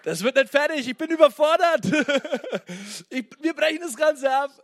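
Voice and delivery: loud, high, overwhelmed complaining to the pastor father, half laughing; panicked call. Retold or imitated. Retelling a phone call to the pastor father, panicked laughing wail. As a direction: wail that it will never get done. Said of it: "Ich hab nicht meine Mutter angerufen".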